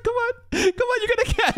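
high-pitched pet-calling voice; mock coaxing. Viewer imitating someone calling a cat, mock coaxing. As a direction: coax it in a high voice, half laughing.

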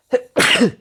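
one sudden loud sneeze; a real sharp sneeze; sneezing. Real, not acted. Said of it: "kusura bakmayın hapşırdım".

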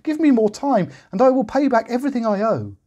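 very high begging voice; pleading cry. A narrator's reading. The second servant begging the first servant. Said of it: "he cried".

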